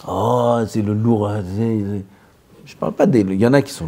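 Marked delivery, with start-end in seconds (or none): none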